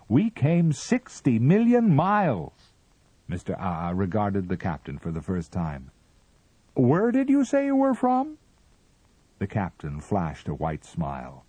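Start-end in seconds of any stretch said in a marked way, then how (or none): 0.1-2.7 high, loud and slow; slow emphatic. A narrator's reading. Captain presses the Martian, loud and slow. 6.7-8.4 much higher and loud; sudden interest. A narrator's reading. Mister Ah Ah suddenly notices the captain, high.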